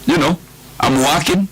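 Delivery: ordinary talk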